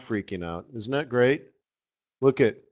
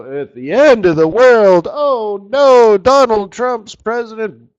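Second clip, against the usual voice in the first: mock panic; 9 semitones higher, 9 dB louder than usual. Mock panic over a chart.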